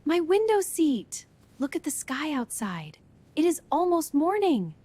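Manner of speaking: ordinary talk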